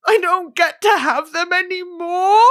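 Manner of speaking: high, drawn-out self-pitying whine; comically tearful, stretched vowels; mock wail. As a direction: wail it slowly like a hard-done-by child. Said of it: "sitting there with my lovely glasses going".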